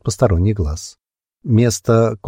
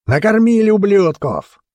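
crowd shout; 11 semitones higher than usual. A narrator's reading. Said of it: "нестройные крики".

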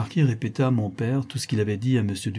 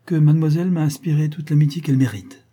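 composed, higher and louder declaration; cool composure; 6 semitones higher, 7 dB louder than usual. A narrator's reading. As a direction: assert it with unruffled poise. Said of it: "avec un sang-froid admirable". (avec un sang-froid affirmé)

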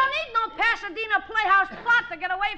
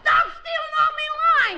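indignant shout; 5 semitones higher, 3 dB louder than usual. Real, not acted. Boy protests stolen lines.

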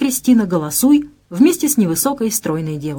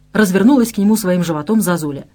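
usual speaking voice

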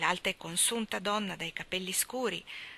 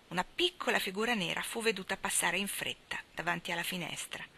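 ordinary delivery, nothing marked

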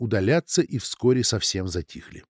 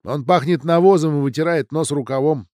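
higher, louder, dismissive retort; disdainful snorting delivery; scornful snort; 5 semitones higher, 6 dB louder than usual. A narrator's reading. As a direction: scoff at the very idea. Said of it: "фыркнула Анна".

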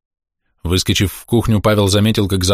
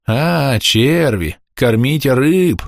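raised, drawn-out eerie call; echo in the head; 6 semitones higher, much slower than usual. A narrator's reading. Words echo inside Pavel's head, echoing call. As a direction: let it ring out slowly like a remembered voice. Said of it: "отозвалось эхом в голове".

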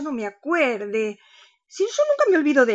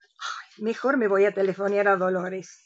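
ordinary delivery

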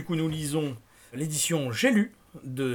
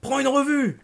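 high, loud urgent command; panicked shouted instruction; shouted order; 13 semitones higher, 8 dB louder than usual. A narrator's reading. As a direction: yell it over your shoulder. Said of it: "lui cria-t-il".

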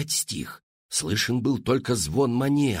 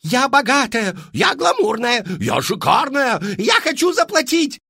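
high, loud boasting cries; eager shouting; 13 semitones higher, 6 dB louder than usual. A narrator's reading. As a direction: shout to be picked. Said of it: "принялись выкрикивать". (громкие высокие выкрики дам)

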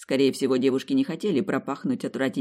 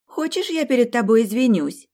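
annoyed; 9 semitones higher, 6 dB louder than usual. A narrator's reading. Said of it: "раздосадованно послышалось сзади".

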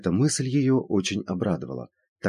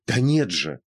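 higher, insistent objection; interrupting protest; 4 semitones higher, 4 dB louder, slower than usual. A narrator's reading. Woman cutting off her sceptical friend, interrupting protest. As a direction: cut in with an emphatic denial. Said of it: "перебила её Евгения".

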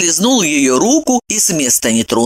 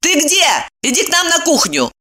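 angry; 11 semitones higher than usual. Acted. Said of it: "сердито спросила".

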